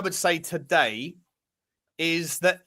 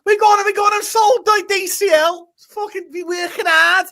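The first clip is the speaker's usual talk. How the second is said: much higher, louder panicked rant; mock outraged shouting; 9 semitones higher, 5 dB louder than usual. Fan realising the sold striker scores, mock outraged shout. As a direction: wail in hammed-up disbelief.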